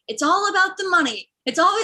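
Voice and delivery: high-pitched mock rant; upset driver. Driver ranting at his passenger, high mock upset. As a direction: imitate the driver's aggrieved outburst. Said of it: "the driver just was very upset".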